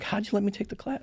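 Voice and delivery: high-pitched incredulous question; retold protest. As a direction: replay a baffled objection in a high voice. Retells protesting the lifeguard mistake.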